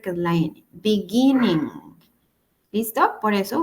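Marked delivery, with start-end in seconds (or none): 0.7-1.7 Beginning with the stress on GIN. Shown as a demonstration. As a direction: hit the middle syllable of Beginning. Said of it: "debo duplicar la última letra". (Beginning con acento en GIN)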